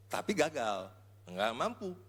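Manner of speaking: ordinary talk, nothing marked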